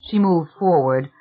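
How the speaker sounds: usual speaking voice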